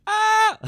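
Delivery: sudden high scream of fright. Real, not acted. Player startled by a monster, shrieking. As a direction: shriek at the scare. Jump scare in horror game.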